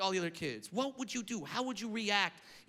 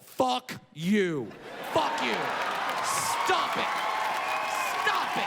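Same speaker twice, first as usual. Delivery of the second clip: building rant; 3 semitones higher, slower than usual. Real, not acted. Comic ranting at overprotective parents, building angry. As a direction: build from curse to shout. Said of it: "ranting at soft parents".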